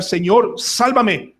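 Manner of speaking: high-pitched plea without full volume; mild cry of rescue, repeated after a pause; crying out to God. Acted. Preacher acting his daily cry to the Lord, crying out. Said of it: "todos los días debo gritar".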